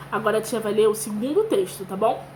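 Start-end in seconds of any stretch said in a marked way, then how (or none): none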